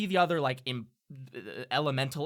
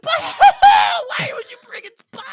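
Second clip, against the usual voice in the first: panicked imp; 17 dB louder than usual. Acted.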